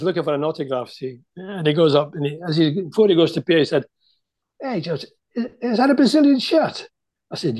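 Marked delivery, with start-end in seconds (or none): 4.6-6.9 put-on high-pitched Scouse kid calling out; little Scouse kid. Young Scouse kid asking George about his shirt, high child's voice. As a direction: pipe up like a cheeky local child. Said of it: "this little Scouse kid comes up".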